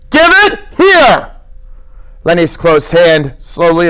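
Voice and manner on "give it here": louder, higher, slow stern command; harsher order. Acted. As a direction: insist harder this time. George repeats order.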